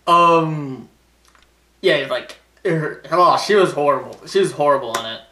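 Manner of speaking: ordinary talk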